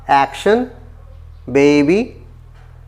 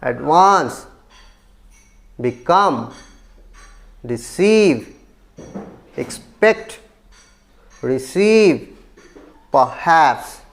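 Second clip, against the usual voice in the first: Advance, become, receive, expect, receive, perhaps: each second syllable held and lifted; stress on second syllable; 3 semitones lower, much slower than usual. Shown as a demonstration. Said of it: "stress on the second syllable". (Advance, become, receive, expect, receive, perhaps के दूसरे syllable पर ज़ोर)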